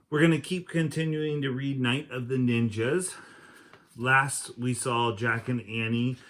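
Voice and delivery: put-on lively read-aloud voice for kids; reading to children. A narrator's reading. Teacher reading a chapter book to kids, lively read-aloud. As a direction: read it out like story time for the class.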